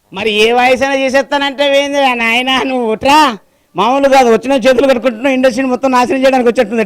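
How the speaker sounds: exasperated senior's loud rant; mock scolding. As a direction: scold the rookie at full volume. Senior mimic scolds the rookie.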